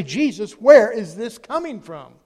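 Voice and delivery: loud, slow, pleading call upward; preacher voicing a prayer at full force; desperate cry to Jesus. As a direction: cry it out from the corner.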